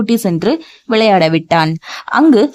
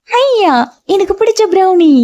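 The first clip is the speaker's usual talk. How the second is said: put-on childlike delight; 11 semitones higher than usual. A narrator's reading.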